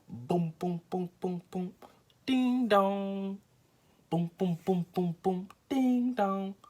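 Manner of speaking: loud high knocking sounds boom and ding dong; imitated pounding. Imitating the stranger's pounding on the door, loud and high. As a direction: beat out the knocks and doorbell loudly. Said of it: "it wasn't like no little".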